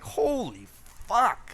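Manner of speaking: a shocked yelp at the sweet taste. Retold or imitated. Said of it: "and I'm like".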